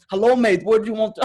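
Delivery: high, loud Russian cabbie attempt; hammed-up, amateur Russian accent; bad Russian accent. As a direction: push the Russian accent harder, badly. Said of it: "'cause I'm shit at accents".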